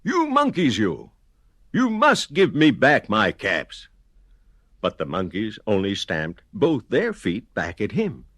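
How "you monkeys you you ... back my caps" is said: shouting. Acted.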